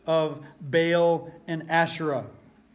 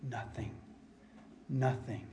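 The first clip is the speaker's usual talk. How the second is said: hushed; 6 semitones lower, 13 dB quieter than usual. Acted. Preacher stresses the silence.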